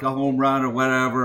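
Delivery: ordinary talk, nothing marked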